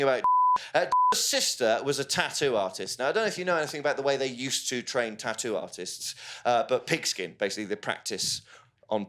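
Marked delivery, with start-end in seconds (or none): none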